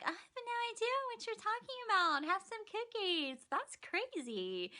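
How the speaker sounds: high, soft, sweet feigned innocence; innocent act. Retold or imitated. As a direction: play dumb in a high soft voice. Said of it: "And she's like".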